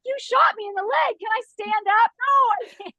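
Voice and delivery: high, bright-eyed movie quote voice, well up in pitch; Austin Powers. Retold or imitated. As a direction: quote the film character way up high. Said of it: "It's from Austin Powers when he's like".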